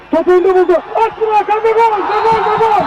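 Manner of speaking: rising, shouting the goal; excited goal call. Real, not acted. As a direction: build up and explode on gol. Goal call in live commentary.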